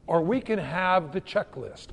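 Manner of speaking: ordinary delivery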